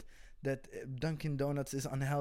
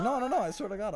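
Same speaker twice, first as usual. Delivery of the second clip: insistent protest; 9 semitones higher, 5 dB louder, faster than usual. Retold or imitated. Comic to disbelieving crowd, high insistent protest. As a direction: pitch jumps higher and louder in a frantic denial.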